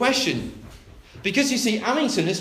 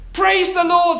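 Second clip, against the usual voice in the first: high, loud burst of praise; shouting; 12 semitones higher, 8 dB louder than usual. Acted. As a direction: shout it out, undeterred by the neighbour. Woman's repeated shout of praise.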